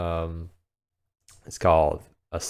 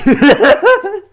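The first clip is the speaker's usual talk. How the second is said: loud high laugh; 15 dB louder than usual. Real, not acted. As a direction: burst of high-pitched laughter. Laughs at own accent bit.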